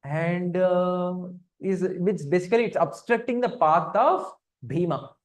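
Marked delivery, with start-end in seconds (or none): none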